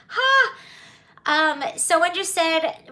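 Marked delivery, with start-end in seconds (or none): 0.1-0.5 sharp laugh. Real, not acted. Laughing at a viewer's joke.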